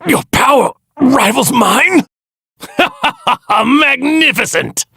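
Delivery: high-pitched cry of disbelief turning to glee; stunned high shout. Acted. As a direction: shout it high, shocked, then laugh with delight. Villain shocked then delighted in battle.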